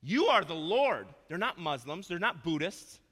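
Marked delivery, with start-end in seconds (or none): 0.0-1.1 louder and higher, slowed with weight; loud preacher's declaration; fervent proclamation. Acted. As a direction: proclaim it like a worship cry. Quoting believers in worship.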